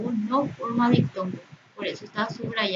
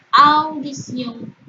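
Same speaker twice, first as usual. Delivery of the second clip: "Audición" stretched slowly, louder; drawn-out example word; 4 semitones higher, 7 dB louder, much slower than usual. Shown as a demonstration. Teacher presenting a word for diphthong analysis, drawn out. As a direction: say "Audición" loud and slow, letting the vowels be heard.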